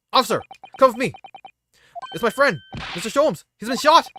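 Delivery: high, loud plea for help; urgent alarm. Acted. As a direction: beg an officer urgently to come. Pleading for help for shot friend.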